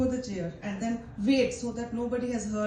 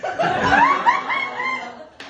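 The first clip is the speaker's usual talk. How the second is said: loud high burst of laughter; 8 dB louder than usual. Real, not acted. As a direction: break into laughter. Laughs at own vow.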